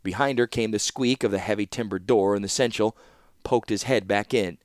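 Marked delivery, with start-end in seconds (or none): none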